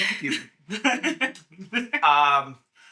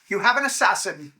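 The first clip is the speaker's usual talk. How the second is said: ordinary talk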